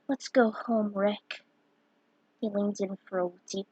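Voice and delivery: soft, quiet fanfic reading with hushed character lines; story read aloud. A narrator's reading. Vlogger reading his fic to viewers, softly.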